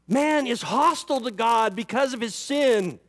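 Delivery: normal talking voice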